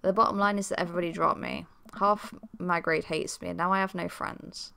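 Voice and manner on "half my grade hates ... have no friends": plain reading voice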